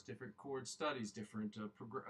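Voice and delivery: normal talking voice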